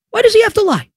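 exasperated squeal of a question; high-pitched incredulity. Real, not acted. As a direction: pitch leaps way up in disbelief. Incredulous repeat, escalating over the lie.

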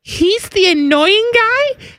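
put-on high, slow, amazed teen girl; surprised girlish voice. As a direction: gasp it out like a teenage daughter. Daughter quoted, surprised.

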